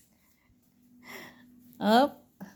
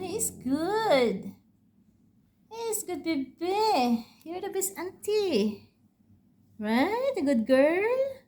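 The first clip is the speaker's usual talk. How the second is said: cooing to a pet; 7 semitones higher, much slower than usual. Real, not acted. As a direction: coo praise at a cat, stretching the words. Praising the cat, baby talk.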